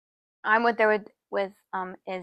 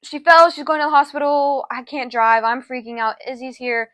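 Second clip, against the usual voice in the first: higher, louder panicked call to mom; 5 semitones higher, 7 dB louder than usual. Retold or imitated. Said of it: "So I'm like".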